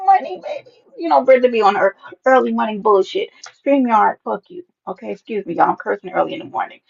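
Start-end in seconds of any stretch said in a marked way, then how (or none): none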